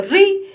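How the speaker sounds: री called out high and bright; answer ri aloud. Shown as a demonstration. Teacher answers her own question with a bright called री. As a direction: sing out री as the class answer. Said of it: "क्या बोलते हैं?".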